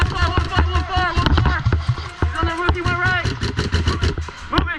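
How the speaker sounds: loud urgent callout; shouted callout. Real, not acted. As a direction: call it out across the field. Calling enemy position in firefight.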